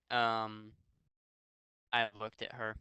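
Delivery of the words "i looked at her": ordinary talk, nothing marked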